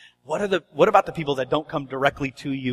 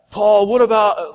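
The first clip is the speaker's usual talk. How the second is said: slightly higher, slower, lightly acted questioner; eager questioner; 4 semitones higher, 6 dB louder, slower than usual. Someone springing a question on the pastor, lightly hammed-up. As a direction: draw out the question, a touch louder.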